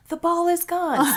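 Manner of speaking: magic-shop clerk dazzling six-year-olds; magician reveal. Shown as a demonstration. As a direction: present the vanished ball with a flourish. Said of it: "you're like".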